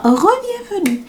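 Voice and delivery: high singsong greeting; playful welcome. Pretend. Greeting returning viewers, playful high singsong. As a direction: pitch it way up, teasing and cheery.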